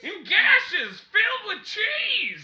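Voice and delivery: slow, loud, high crazed shriek; unhinged villain. Pretend. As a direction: drag it out like a maniac. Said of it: "You sound like a madman".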